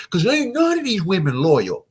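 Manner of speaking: high, loud mock rant; worked-up complaint pushed up high; getting all heated. Man on a date griping about women, heated. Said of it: "you start getting all heated".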